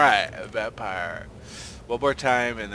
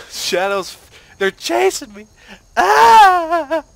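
high, loud panicked shout; panicked yelling; 16 dB louder, much slower than usual. Real, not acted. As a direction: yell in sudden panic. Panicked at chasing shadows.

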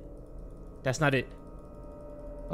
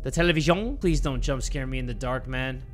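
ordinary talk, nothing marked

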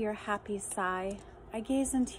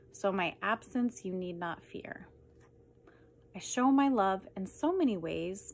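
usual speaking voice

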